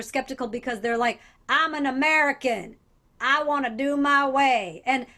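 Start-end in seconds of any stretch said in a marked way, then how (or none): 1.5-4.8 mock Southern accent. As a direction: imitate a defiant American with an Alabama accent. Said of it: "I'm allowed to do that accent".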